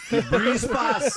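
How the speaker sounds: loud, high and slow, laughing through the words; laughing punchline. Real, not acted. Telling friends the snake was a bag, laughing loudly. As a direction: drag it out while cracking up.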